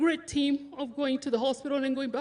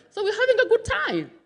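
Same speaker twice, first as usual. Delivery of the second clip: high, bubbling laugh through the words; 10 semitones higher, 5 dB louder than usual. Real, not acted. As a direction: let the joke of it spill into laughter. Fond memory of quiet friendship.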